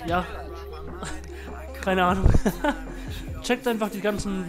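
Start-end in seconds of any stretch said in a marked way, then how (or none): none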